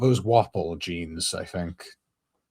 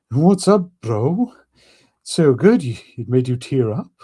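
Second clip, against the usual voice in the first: put-on English accent; 7 semitones higher, 7 dB louder than usual. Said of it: "saying bro in an English accent feels weird".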